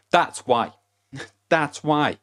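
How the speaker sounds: loud, high emphatic punchline; a loud emphatic that's why. Real, not acted. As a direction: slam the answer home, then laugh.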